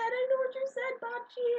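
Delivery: very high-pitched gushing; retold excited squeal. Granddaughter admitting it to grandma, excited squeal. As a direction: gush it up high, close to laughing.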